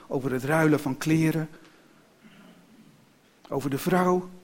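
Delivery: plain reading voice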